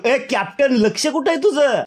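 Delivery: a retold yell at the captain; imitating Arbaz shouting across the room; mock shout. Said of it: "Arbaaz सूरजला ओरडतो".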